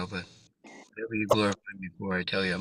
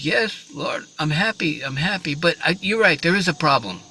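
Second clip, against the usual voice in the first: higher-pitched, halting admission; raised voice of a troubled rabbi; hesitant old rabbi; 8 semitones higher, 5 dB louder than usual. Acted. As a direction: play a rabbi reluctantly owning up to trouble. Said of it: "So the rabbi says".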